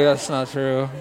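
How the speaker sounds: laughing mock. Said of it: "You're like".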